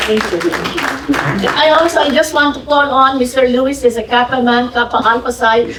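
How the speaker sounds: normal talking voice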